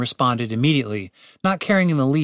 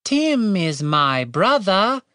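put-on very slow, higher British accent; drawn-out, careful British accent; attempted British accent; 8 semitones higher, much slower than usual. A narrator's reading. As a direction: enunciate painstakingly in British. Catherine explains in attempted accent.

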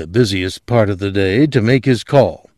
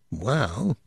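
struggling; 12 dB quieter than usual. Acted.

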